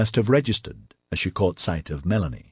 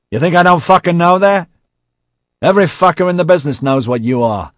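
loud, high furious outburst; angry shouting; 11 semitones higher, 10 dB louder, faster than usual. A narrator's reading. J C abuses Melanie in a quarrel, shouting angrily. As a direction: hurl it at full volume.

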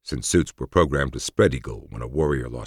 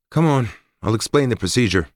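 brisk woman's voice; 9 semitones higher, 5 dB louder than usual. Acted. Narrator voices Forrest.

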